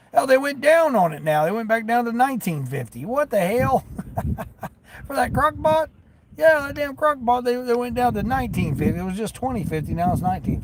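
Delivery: squeaky what-the-heck disbelief at the price; surprised disbelief. Real, not acted. Driver reacting to the crock pot price drop, high surprised disbelief. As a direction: pitch jumps way up in indignant amazement.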